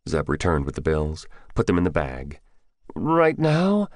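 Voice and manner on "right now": high, unsure question; a nervous storekeeper asking, unsure; hesitant, uncertain young man. Acted. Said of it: "asked uncertainly".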